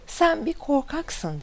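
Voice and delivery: high, slow narrated yell; understated storyteller's shout of annoyance; mild angry shout. A narrator's reading. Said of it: "diye tekrar bağırıyor".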